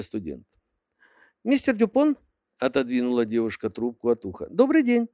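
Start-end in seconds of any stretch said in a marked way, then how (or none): 1.4-2.2 put-on high girly secretary voice; sweet, high-pitched young secretary; cartoon princess. A narrator's reading. 4.5-5.1 put-on high girly secretary voice; sweet, high-pitched young secretary; cartoon princess. A narrator's reading.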